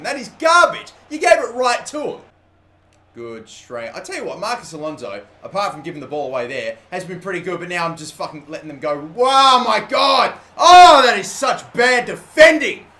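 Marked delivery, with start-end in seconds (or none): 0.0-2.2 loud, higher, furious yelling at the screen; angry outburst. Real, not acted. Gamer yelling at his own teammate's pass, furious. 9.1-12.9 loud, higher and slow disbelieving groan; exasperated outcry. Real, not acted. Streamer watching his team concede, loud slow exasperation.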